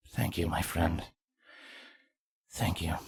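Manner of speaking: soft, hushed gratitude; barely above a breath, touched; quietly moved thanks. Acted. Cardis thanking Vladimir for honoring fallen friends, hushed and moved.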